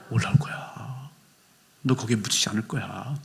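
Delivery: whispered reassurance; soft whisper. Acted. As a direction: quietly promise he won't stay there. God's promise whispered. (속삭이는 위로)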